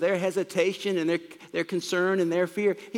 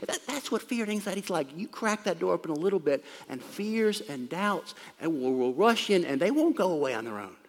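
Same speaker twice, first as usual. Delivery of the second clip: ordinary talk, nothing marked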